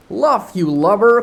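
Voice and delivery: put-on loud, high pirate cry, no Irish accent; just shout it like a sailor; own-way read. Smee yelling at his mate, plain pirate shout. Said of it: "gonna read it my own way".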